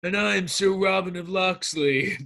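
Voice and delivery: drawn-out, hammed-up introduction; mock movie hero. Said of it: "He's like".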